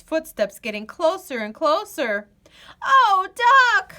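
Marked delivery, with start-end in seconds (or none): 2.8-3.9 high, loud frightened wail; scared groan. Acted. Goose groans in fear to Duck. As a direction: moan in dread. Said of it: "Goose groaned".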